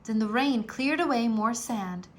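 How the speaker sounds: ordinary delivery, nothing marked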